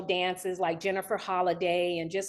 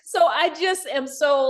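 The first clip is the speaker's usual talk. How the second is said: laughing; 6 semitones higher, 6 dB louder than usual. Real, not acted. Emotional guest laughing through feelings.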